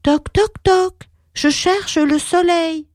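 put-on boy knocking.

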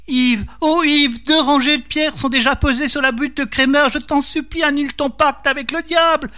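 acted pleading in a high-pitched wife voice; wife's cries. A narrator's reading. Wife begging husband to cancel the pact, high wailing plea. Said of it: "réveillé par les cris de Marie".

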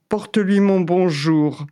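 lazy yawning tiger. Acted. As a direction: speak through a yawn. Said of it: "dit le tigre en bâillant".

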